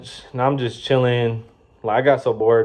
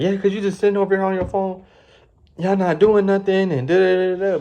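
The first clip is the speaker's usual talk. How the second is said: high, nagging mimic of an angry boss; mock the boss scolding, higher and louder; mad manager; 10 semitones higher, 3 dB louder than usual. Manager scolding idle workers, mocking high nag. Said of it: "she get mad".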